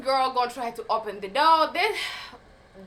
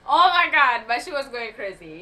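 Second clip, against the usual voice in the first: ordinary delivery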